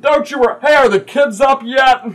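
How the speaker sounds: loud, high, hearty character voice; boisterous Bron. Acted. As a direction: boom it out eagerly, ending in a big laugh.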